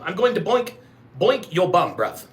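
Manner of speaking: mock British street slang; British accent. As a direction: put on a cheeky British accent. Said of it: "That's what I like about British people".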